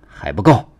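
restrained angry roar, voiced by a narrator; growing force on a short, angry outburst. A narrator's reading. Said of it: "他怒吼一声".

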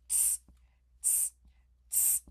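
soft tongue-click tss; tut-tut like cheeky monkeys; monkey tsking. Acted. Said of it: "and said".

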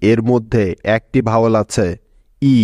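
normal talking voice

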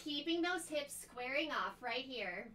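normal talking voice

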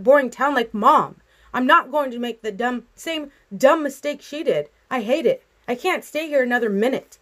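high, emphatic, exasperated protest; upset outburst. A narrator's reading. Girl hurting her father to escape, upset outburst.

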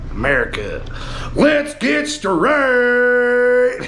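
drawn-out, very slow mock American accent; put-on American accent. Vlogger tries his American accent on New York. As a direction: stretch it out like a bad American twang. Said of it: "Let's try and do it in an American accent".

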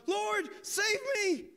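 high, drawn-out cry for rescue. A narrator's reading. Peter beginning to sink, crying out to Jesus. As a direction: cry out slowly and high, pleading to be saved. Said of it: "he cried out".

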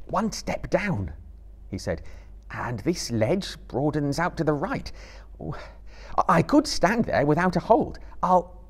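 calling up while feeling for the ledge; Frodo. A narrator's reading.

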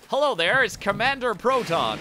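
high, loud mock serial announcer; old TV serial hero. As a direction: hail the audience like a hammy TV hero. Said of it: "It's like".

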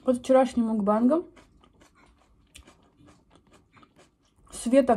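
chewing while talking; speaking around food; mouth partly full. Real, not acted. Seen 3: Bite at -4; chews and picks at mouth while speaking.

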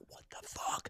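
hushed, breathy astonishment; whispered disbelief. Retold or imitated. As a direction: whisper in awe. Crowd whispering amazed.

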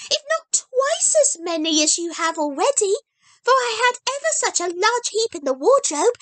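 put-on high, bright child voice, earnest; a small girl promising with full conviction; eager little girl Heidi. A narrator's reading. Heidi explains her plan.

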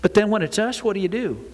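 ordinary delivery

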